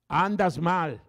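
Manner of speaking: booming rebuke. Acted. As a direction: shout "Andas mal" as a father's rebuke. God's voice warning a sinner.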